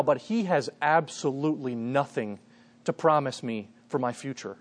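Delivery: ordinary talk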